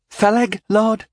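put-on high, squeaky boy's voice; squeaked. A narrator's reading. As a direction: squeak it out nervously. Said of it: "he squeaked".

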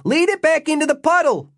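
high-pitched shouted instruction; yelling. A narrator's reading. Jimmy telling the others how to stop the creature, yelling. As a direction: call it out urgently, voice pushed way up. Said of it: "he yelled to the others".

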